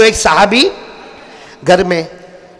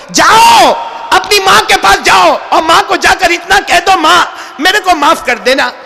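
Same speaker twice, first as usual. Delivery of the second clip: fiery preaching; 9 semitones higher than usual. Acted. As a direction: preach it with full force to the crowd. Preaching at full force. (जोश भरी ऊँची आवाज़ में तक़रीर)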